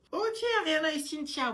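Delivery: sharp call-out. Acted. Teacher cuts off two whining pupils, loud and sharp. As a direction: snap their names to stop the drama.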